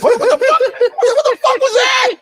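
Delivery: very high, laughing mock shout; incredulous outburst. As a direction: blurt it out in hammed-up disbelief. Mock shock at Idris's real accent.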